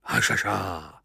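low-key drawn-out "Hašaša"; sleepy native. A narrator's reading. Smiling native answers goodnight, gently sleepy. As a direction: say "Hašaša" like a bedtime word. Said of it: "znělo to trochu jako hajaja".

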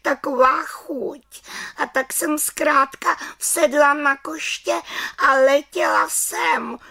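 little witch trembling before the head witch; frightened little witch. Acted. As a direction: answer like a scared little girl. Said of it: "se třásla strachy".

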